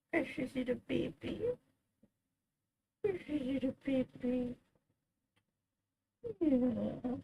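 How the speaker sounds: tender pet whisper-coo. Real, not acted. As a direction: hush it to the kitten. Cooing softly to cat.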